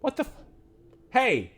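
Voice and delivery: put-on high, loud childish call of hey mister; a kid shouting up at the stranger. The courier kid calling out to the prisoner, high and loud.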